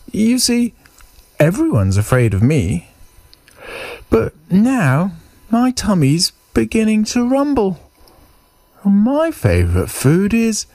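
high mouse voice, building a threat; small mouse acting big; cocky little mouse. Mouse tells Gruffalo his tummy rumbles, high cocky voice.

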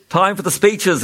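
announcing. A narrator's reading. King opening the speeches, announcing. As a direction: announce it to the table. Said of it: "announced the king".

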